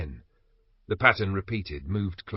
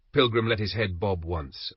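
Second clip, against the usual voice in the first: plain reading voice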